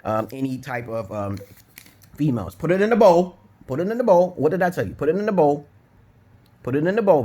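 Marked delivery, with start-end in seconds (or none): 2.6-7.2 loud scolding. Real, not acted. Telling child off on camera.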